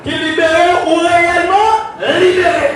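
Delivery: high, loud revival preacher; full-force preaching. Real, not acted. Preacher rousing the congregation with slogans, high impassioned shout. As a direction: ring out each phrase to rouse the church.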